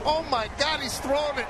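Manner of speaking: high, loud retold alarm, amused; mock panicked teammate. Announcer retelling Chris's fright at the throw, mock panic. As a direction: imitate a teammate's startled outcry. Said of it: "so Chris says, "I'm just sitting there".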